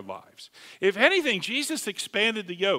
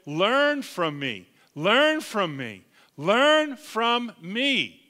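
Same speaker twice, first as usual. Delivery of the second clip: slow earnest repetition; 3 semitones higher, much slower than usual. Acted. Repeated slow quote.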